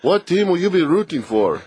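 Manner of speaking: high, hammed-up German accent; radio host fakes a German reporter for a laugh; mock German accent. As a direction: put on a playful German reporter voice. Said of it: "maybe you can do a German".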